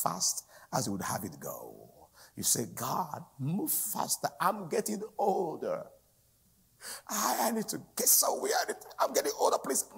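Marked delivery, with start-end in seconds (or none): 2.7-9.9 high-pitched, louder whining plea; impatient mock pleading. Believer hurrying God for fear of ageing, mock impatient pleading. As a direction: beg urgently, building into a hammed-up whine.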